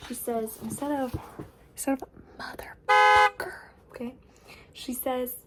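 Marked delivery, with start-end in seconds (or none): 1.7-3.5 much higher and louder, blurting the swear; exasperated outburst. Real, not acted. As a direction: burst out with it in disbelief.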